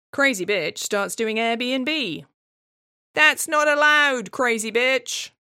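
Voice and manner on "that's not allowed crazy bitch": slow, loud, high outraged neighbour; mock dwarf rant. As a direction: drag it out like a fussy neighbour. Dwarf voiced, ranting.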